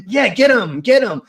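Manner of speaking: a gleeful father voiced; imitating dad cheering. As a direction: egg the guy on as dad.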